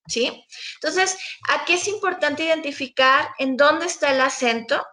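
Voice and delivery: usual speaking voice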